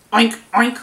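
loud imitated oinking; pig oinks. Shown as a demonstration. Reader showing children what pigs say, oinking. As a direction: oink like a pig. Imitates a pig's usual noise.

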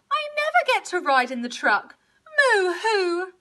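put-upon cow crying; bawling cow. A narrator's reading. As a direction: whine it tearfully as a sulky cow. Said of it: "bawled Cow".

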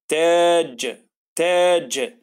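تاج slow, the ta drawn out; stretched تاج. Shown as a demonstration. Teacher reading تاج with a long, slow vowel. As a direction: hold the a in تاج. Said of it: "في صوت التاء".

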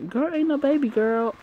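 high, sassy dismissal; sassy retort. Real, not acted. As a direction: shut it down with sass. Reader sasses the character.

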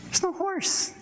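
mock-betrayed and astonished; incredulous outrage. Real, not acted. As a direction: protest as if cheated. Indignant about Sunday school teaching.

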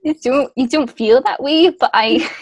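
a touch of Scottish burr; mild put-on Scottish accent. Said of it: "I'm going Scottish again".

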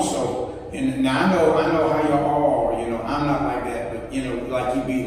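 normal talking voice

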